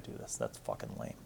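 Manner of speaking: low whispered self-put-down; whispered aside. Real, not acted. Gives up on the accent, whispering to himself. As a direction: whisper it under your breath, disgusted.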